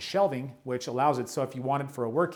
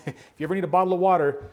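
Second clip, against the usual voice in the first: quick, laughing joke, louder; laughing aside; 4 semitones higher, 6 dB louder, much faster than usual. Real, not acted. Touring a builder's kitchen, joking to viewers about bottled water, laughing. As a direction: toss off the joke through a laugh.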